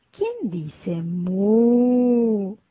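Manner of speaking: mugido lento. Shown as a demonstration. Juego de sonidos de animales.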